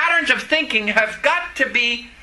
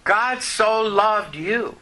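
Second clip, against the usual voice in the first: slow and deliberate; much slower than usual. Acted. Preacher personalising the verse slowly.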